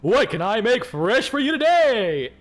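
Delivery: loud, drawn-out showman's greeting; big, slow, booming host announcement; talk show host. Shown as a demonstration. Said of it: "put on talk show host voice".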